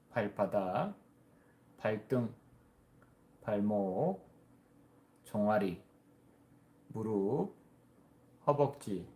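measured body-scan guidance with long pauses; guided meditation. Real, not acted. (차분히 이끄는 명상 안내)

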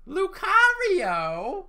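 long, very high, loud screech; Long Island screech. Retold or imitated. Reenacting a friend's screech at an unexpected unlock, long loud Long Island shriek. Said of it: "Long Island screech".

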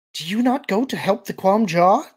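high, loud worried question; anxious. A narrator's reading. Alien asks as Luke starts past, anxious. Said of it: "asked anxiously".

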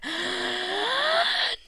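loud, rising gasp; heavy breath. Real, not acted. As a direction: suck in a long, high breath. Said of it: "breathing so heavily".

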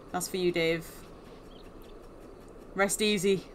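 ordinary delivery

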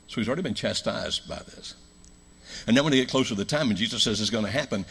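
ordinary talk

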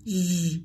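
Z sounded as a held zh buzz; voiced zh. Shown as a demonstration. Teacher isolates the sound of S, held zh. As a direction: sustain the buzzing zh written "Z". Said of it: "được đọc là âm".

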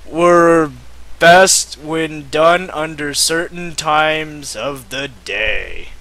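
normal talking voice

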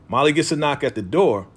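ordinary talk

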